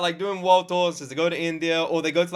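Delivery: normal talking voice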